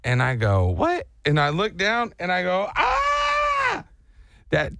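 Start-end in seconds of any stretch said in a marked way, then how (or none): 0.7-1.1 high, rising question; startled little yelp of a question; puzzled surprise. Retold or imitated. Rider feels a tickle on his knee, surprised. 2.8-3.8 high, held mock scream; long high-pitched cry of alarm; retold scream. Rider sees a bee on his knee, mock scream. Retelling the moment he saw bee.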